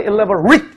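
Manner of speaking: "elaborate" ending in a short "-rit"; "elaborate" with "rit" at the end instead of "rate"; adjective pronunciation. Shown as a demonstration. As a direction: show "elaborate" as an adjective, final vowel reduced. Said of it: "이런 식으로 얘기해주면 이제 형용사형을".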